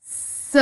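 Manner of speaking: स as a bare unvoiced hiss; just breathe out the s of स; whispered s. Shown as a demonstration. Teacher hissing the s sound of beds softly. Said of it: "एस की sound क्या आ रही है?".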